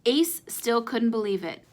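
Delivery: ordinary delivery, nothing marked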